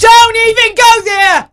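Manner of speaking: mock warning. Real, not acted. Warding off topic.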